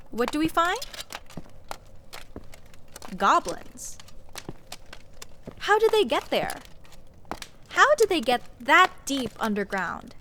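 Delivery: baffled outrage at the goblins; exasperated rant. Acted. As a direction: demand it like it makes no sense. Ranting about goblins in dungeon.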